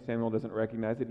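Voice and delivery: ordinary delivery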